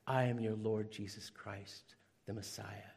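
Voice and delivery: low, quiet, deadly serious declaration; solemn low claim. Retold or imitated. Stranger after church claims to be Jesus, low and quiet. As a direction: say it low and flat like a calm madman.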